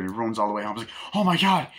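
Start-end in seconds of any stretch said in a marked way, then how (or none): 1.1-1.7 high, loud exclamation; acted gasp of relief; mock astonished relief. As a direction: imitate a girlfriend shocked to see him home. Girlfriend sees missing David return.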